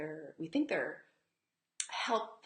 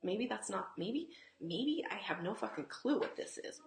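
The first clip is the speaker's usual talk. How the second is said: normal talking voice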